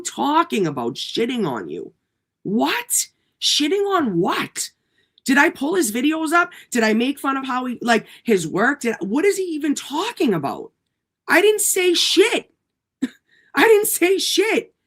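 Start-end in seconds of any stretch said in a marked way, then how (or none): 2.4-4.7 high, drawn-out disbelief; mock baffled outrage; pitched-up stunned question; incredulous. Real, not acted. Angry rant at a critic. 11.3-14.7 high, loud exasperated protest; outraged disbelief, half laughing; pitched-up incredulous outburst; indignant. Real, not acted. Angry rant at a critic.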